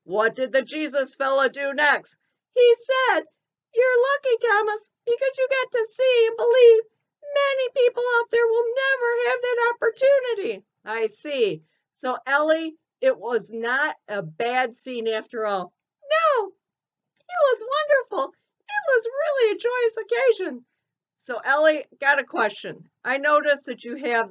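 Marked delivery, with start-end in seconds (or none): 2.5-10.6 high, squeaky cartoon elephant. Ellie quotes Jesus. 16.0-20.6 high, squeaky cartoon elephant. Ellie answers warmly.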